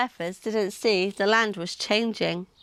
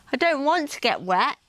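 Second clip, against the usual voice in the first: ordinary delivery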